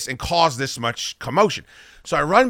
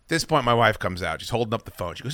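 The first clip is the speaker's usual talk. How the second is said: ordinary talk, nothing marked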